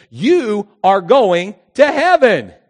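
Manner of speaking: loud, very slow proclamation; preaching climax. Acted. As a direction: proclaim it to the congregation.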